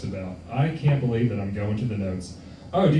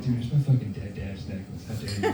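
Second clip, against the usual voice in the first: mock cocky; 5 semitones lower, 7 dB quieter than usual. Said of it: "be really cocky about it".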